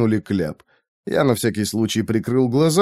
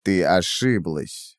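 cold woman's voice; 6 semitones lower, 4 dB quieter, slower than usual. A narrator's reading. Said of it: "сказала женщина".

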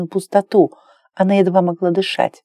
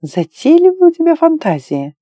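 much higher, lilting remark; high-voiced heroine; 9 semitones higher than usual. A narrator's reading. Claire teases her lover about fantasies, high female voice. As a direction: lift the voice high for the woman. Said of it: "пробормотала Клэр".

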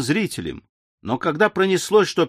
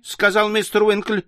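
ordinary delivery

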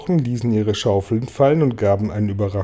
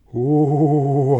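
drawn-out, higher-pitched breathed "oh"; breathed exclamation; 4 semitones higher, 4 dB louder than usual. A narrator's reading. Ladybird takes fright at the cloud figures, breathed out. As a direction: let the word out on a long breath. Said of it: "hauchte Marienkäferchen".

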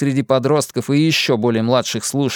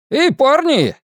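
громкий высокий окрик; окликнуть парней во весь голос; восклицание; 13 semitones higher, 4 dB louder, slower than usual. A narrator's reading. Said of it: "воскликнул мистер Пиперс". (продавец кричит мальчишкам вслед, громко)

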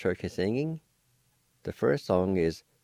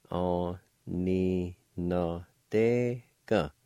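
Aninadega stretched syllable by syllable, very slowly; much slower than usual. Shown as a demonstration. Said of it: "It's pronounced like this".

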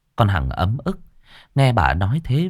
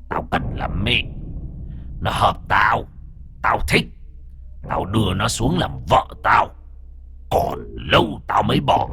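eerie ghost voice. Acted. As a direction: speak as a possessing spirit. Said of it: "Nó cất cái giọng ma quái ghê rợn".

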